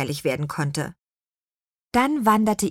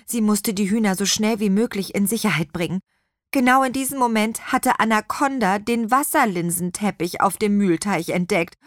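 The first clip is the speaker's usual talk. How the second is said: ordinary delivery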